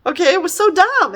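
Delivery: high, exasperated complaint; annoyed high-pitched rant. Real, not acted. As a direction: throw your hands up at it. Exasperated about the book.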